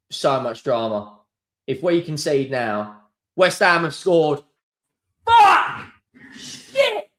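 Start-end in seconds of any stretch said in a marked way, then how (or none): 5.2-7.1 shrieking high curse; anguished swearing. Real, not acted. Fan reacting as rivals score, shrieking curses. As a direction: yelp in despair.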